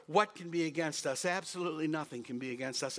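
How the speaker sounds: ordinary talk, nothing marked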